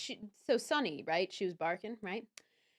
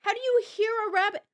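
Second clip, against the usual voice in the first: incredulous; 7 semitones higher, 7 dB louder, much faster than usual. Real, not acted. Amazed at the dog.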